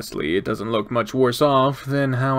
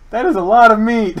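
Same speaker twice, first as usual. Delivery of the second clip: high, loud amazement; amazed exclamation; 10 semitones higher, 7 dB louder than usual. Real, not acted. Exclaims, then repeats it deep.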